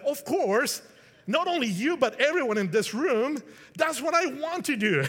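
high, loud, eager answer; eager Paul. Acted. Paul eagerly tells King Agrippa everyone here should believe, excited. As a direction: burst out with eager conviction.

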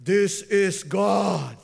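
slow, booming God voice. Retold or imitated. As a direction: boom it down from the sky. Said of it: "and says".

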